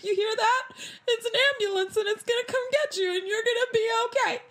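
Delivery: high, half-laughing, half-crying reassurance; panicked reassurance. Retold or imitated. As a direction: reassure her through tears and nervous laughter.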